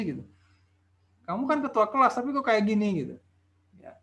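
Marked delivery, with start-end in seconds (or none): 1.2-2.9 higher-pitched challenge to a superior; acted protest. Teteh protesting to a superior at work, indignant mock complaint. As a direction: protest to the boss in a raised pitch. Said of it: "sering protes ke atasannya".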